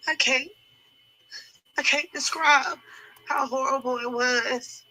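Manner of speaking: high voice close to breaking; real tearful anguish. Real, not acted. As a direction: choke up, unable to describe it. Breaking down over traumatic memory.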